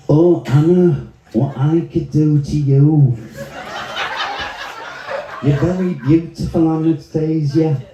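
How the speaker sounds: comic Manchester chat-up voice; Manchester accent. Shown as a demonstration. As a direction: woo her in broad Mancunian. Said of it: "are Manchester".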